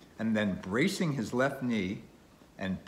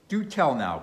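louder, higher exclamation; cried out; 5 semitones higher, 6 dB louder than usual. A narrator's reading. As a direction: cry out as a sceptical old captain. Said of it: "cried Bildad".

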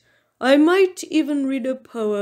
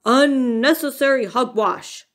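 loud, stuffy bear scolding; 3 semitones higher, 5 dB louder than usual. A narrator's reading. Bear dismisses Mouse's present hunt, scolding. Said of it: "Bear scolded".

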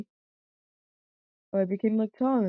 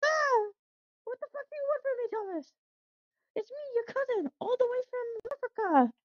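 much higher, nervous little engine voice; squeaky cousin engine; 11 semitones higher, 4 dB quieter than usual. Acted. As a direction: pitch way up like a small anxious train. Plays cousin Jack figure.

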